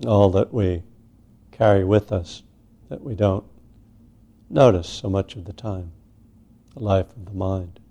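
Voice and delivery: unhurried meditation guidance with long pauses; guided meditation. Real, not acted. Teacher settling a group into sitting meditation, unhurried guidance. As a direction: ease each phrase out, leaving space after it.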